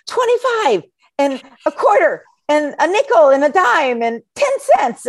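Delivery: put-on high, loud kid guesses; kids yelling out. First graders shouting guesses at the riddle, high and loud. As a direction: blurt like excited first graders. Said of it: "you hear kids yell out".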